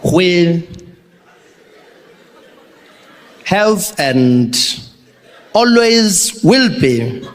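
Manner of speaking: slow, halting speech with odd pauses; Jacob Zuma speech. Retold or imitated. Zuma reading a party speech, slow and halting. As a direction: read it like a proud politician mangling the script. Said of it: "Comes out there, confident, cadence".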